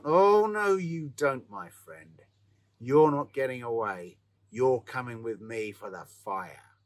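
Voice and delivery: loud, drawn-out call that eases off; crying out. A narrator's reading. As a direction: call out firmly to a struggling captive. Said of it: "the blacksmith cried out".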